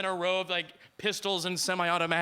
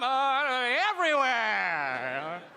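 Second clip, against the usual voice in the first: evasive tourist; 5 semitones higher, much slower than usual. Retold or imitated. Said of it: "committed to the character".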